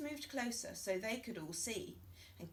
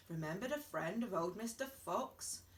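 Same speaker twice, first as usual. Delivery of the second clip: ordinary delivery, nothing marked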